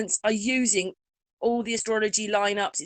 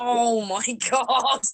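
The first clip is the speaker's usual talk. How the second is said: slow, drawn-out laughing groan; laughing dismay; 2 semitones higher, much slower than usual. Retold or imitated. Recalling her reaction to a possible presidential run, laughing dismay. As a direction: draw it out through an incredulous laugh.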